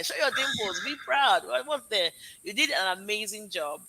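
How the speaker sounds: ordinary delivery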